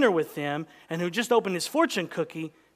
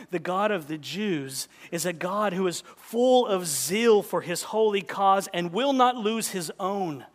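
normal talking voice

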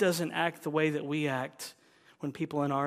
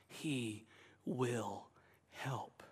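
much softer, unvoiced; whisper; 5 semitones lower, 11 dB quieter, much slower than usual. Real, not acted. Preacher whispering assurance to the congregation. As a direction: breathe the last word gently.

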